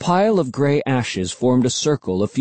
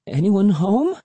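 tentative, higher-pitched questioning call; timid call; 7 semitones higher than usual. A narrator's reading. Sister calling at a stranger's shack, timid call. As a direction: call out cautiously, without raising the volume. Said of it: "came out low and shaky".

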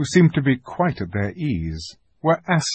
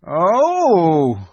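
higher, louder drawn-out "oh"; lightly voiced indignant beaver; beaver character; 9 semitones higher, 8 dB louder than usual. A narrator's reading. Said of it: "said Mister Beaver".

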